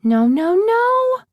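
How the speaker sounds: loud, climbing cry of alarm; horrified cry. Acted. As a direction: cry out as the dog ruins dinner. Dog eating the roast.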